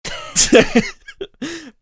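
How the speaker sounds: high loud burst of laughter; real high laugh breaking out; big laugh. Real, not acted. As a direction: crack up. Laughing at the joke.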